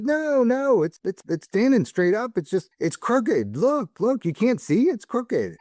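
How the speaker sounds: lightly voiced grandfather, stubbornly sure the wall is crooked; insistent grandfather.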